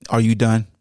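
usual speaking voice

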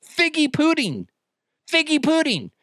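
high, playful relish of the name; silly put-on voice. Host playing with a name he likes, silly high voice.

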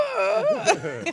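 put-on very high, loud startled baby wail; imitated baby cry. Baby startled by parent's yelling, imitated cry. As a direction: mimic a scared baby's cry.

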